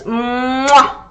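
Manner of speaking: another smacking kiss; blown kiss. Shown as a demonstration. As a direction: lips squeezed, kiss sound. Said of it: "You're squeezing your lips".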